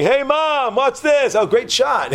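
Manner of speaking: high, cheery call; mock tourist. Tourist on cliff taking selfie.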